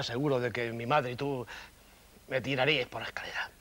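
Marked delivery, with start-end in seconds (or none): 2.3-3.5 lower, breathless whispered confession. Acted. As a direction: drop to a guilty whisper. Confession of fear, voice drops. (susurro culpable y bajo)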